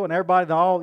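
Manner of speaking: normal talking voice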